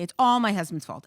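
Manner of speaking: wife venting about chores, in a loud mock complaint; mock blaming outburst. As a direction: belt out the blame like a fed-up wife. Voicing wife blaming husband.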